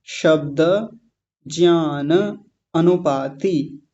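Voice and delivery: शब्द, ज्ञान, अनुपाति slowly as separate words; शब्द ज्ञान अनुपाति word by word, drawn out; words split apart. Shown as a demonstration. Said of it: "इनको तोड़ेंगे तो क्या क्या बनेगा".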